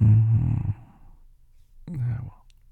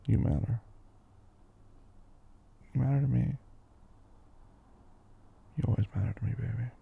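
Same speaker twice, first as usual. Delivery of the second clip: tender; 7 dB quieter than usual. Acted. Tender reassurance to partner.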